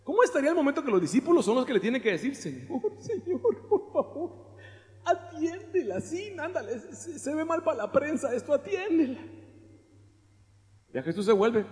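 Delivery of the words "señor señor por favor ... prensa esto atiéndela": high, soft, slow whining; whiny plea. Acted. Disciples nudging Jesus to attend her, high and whiny. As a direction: nag him half-laughing.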